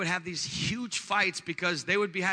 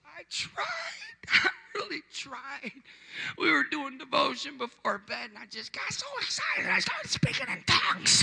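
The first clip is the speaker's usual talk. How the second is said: very high, squeaky tearful confession; mock whimpering; 8 semitones higher than usual. Husband explaining to pastor by phone, high mock-crying voice. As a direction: whine it as if crying, hammed up.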